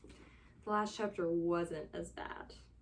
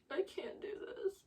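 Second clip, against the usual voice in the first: higher, voiceless whisper of despair; hushed higher whisper; 10 semitones higher, 5 dB quieter than usual. Real, not acted. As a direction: whisper it like you're at your limit. Distressed repeating while reading.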